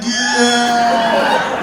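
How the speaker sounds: long, loud mock slow-motion cry; imitated movie punch groan; punched-face yell. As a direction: draw it out like a boxer taking a punch. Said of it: "when he gets punched and his face goes".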